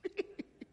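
breathless little giggle, voiceless; a hint of a light, airy giggle; soft giggle. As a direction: giggle quietly like a man amused. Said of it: "Jesus giggled".

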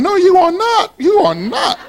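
high-pitched mock protest; playful imitation of a firm denial; mock objection. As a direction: imitate someone indignantly contradicting, pitch jumping up. Imitates congregation members objecting.

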